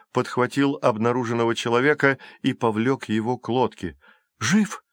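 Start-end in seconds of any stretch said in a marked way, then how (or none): 4.4-4.8 high, urgent one-word question; anxious question. A narrator's reading. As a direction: ask urgently. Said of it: "спросила Калипсо". (высокий тревожный вопрос)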